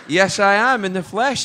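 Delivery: high, loud mock grandeur; mock proud. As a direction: announce yourself like a celebrity, playfully. Proudly confirming his fame to guard.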